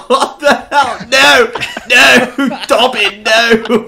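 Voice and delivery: loud laughing "No!" at the dying horse; laughing shout. Real, not acted. To the dying horse Dobbin, a loud laughing shout. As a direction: cry out half laughing, half horrified.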